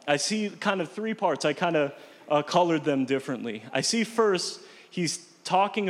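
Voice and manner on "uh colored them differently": normal talking voice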